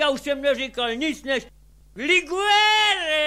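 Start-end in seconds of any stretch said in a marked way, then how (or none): 2.0-3.2 higher and louder, falling call; tipsy demand, pitched up; demanding liqueur. Retold or imitated. Demanding liquor at the shop.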